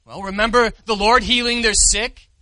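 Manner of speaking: normal talking voice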